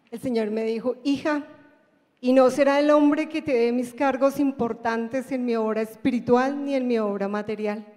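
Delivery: ordinary talk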